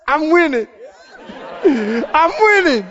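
laughing through I'm winning, slowing down; grinning through the blood. Retold or imitated. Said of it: "he grins through the blood and says".

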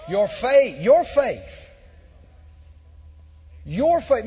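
Your faith, said loud and hammered home; insistent repeated emphasis on faith; stress on faith. Shown as a demonstration. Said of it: "Where did Jesus put the emphasis?".

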